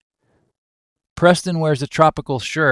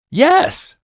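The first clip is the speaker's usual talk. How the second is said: very high-pitched yelp of yes; exclaimed outburst; 4 dB louder than usual. Acted. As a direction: burst out, pitch shooting way up. Wilson's outburst, then quiets down.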